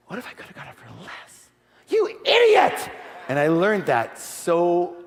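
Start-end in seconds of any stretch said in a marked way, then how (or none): 0.1-1.5 worried thought. Retold or imitated. Private doubt to himself. 1.9-2.9 very high, slow yell at yourself; a furious scream at yourself; self-scolding yell. Retold or imitated. Scolding himself over the deal.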